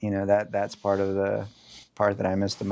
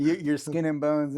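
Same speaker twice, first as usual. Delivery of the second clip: grandma voice; 6 semitones higher, slower than usual. Retold or imitated.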